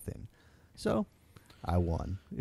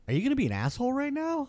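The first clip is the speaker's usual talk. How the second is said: much higher, fast, incredulous; high, quick disbelieving jab; incredulous challenge; faster than usual. Retold or imitated. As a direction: fire it off in disbelief. Matt confronting the guy.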